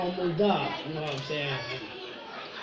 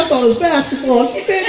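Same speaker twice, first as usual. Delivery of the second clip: ordinary talk, nothing marked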